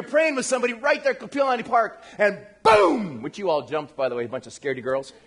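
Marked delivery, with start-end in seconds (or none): 2.6-3.2 sudden loud, drawn-out boom; booming sound effect. Retold or imitated. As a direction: burst out with a long boom.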